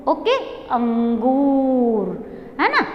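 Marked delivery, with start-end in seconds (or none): 0.7-2.1 "अंगूर" very slow with a long ng; pronunciation demo. Shown as a demonstration. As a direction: hum the nasal and draw out "अंगूर". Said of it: "ऐसे pronounce करोगे". ("अंगूर" धीरे, अनुस्वार खींचकर)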